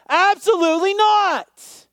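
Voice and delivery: loud, high, slow and emphatic; emphatic shout. Acted. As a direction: shout it out with full conviction. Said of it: "It's the same word. You ready?".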